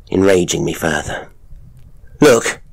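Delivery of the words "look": loud, high outburst; cry out. Acted. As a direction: cry out to stop them. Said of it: "I cried".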